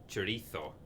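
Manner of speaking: "chorizo" with a th for the z; lisped chorizo. Retold or imitated. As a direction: say "chorizo" Spanish-style, cho-REE-tho. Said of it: "which is how I say it".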